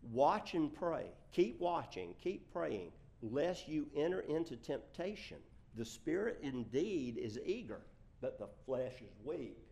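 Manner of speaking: deliberate, forceful reading aloud; scripture reading. Acted. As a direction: project each command to the congregation. Reads scripture aloud to congregation.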